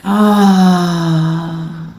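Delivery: long audible "ah" sigh, falling away; exhaling sigh. Shown as a demonstration. Teacher leading breathing before relaxation, exhaling sigh. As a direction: breathe it all out on an open "ah". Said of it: "exhale with a sigh".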